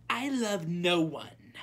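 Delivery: high, loud, haughty princess; proud royal declaration. A narrator's reading. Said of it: "said the princess proudly".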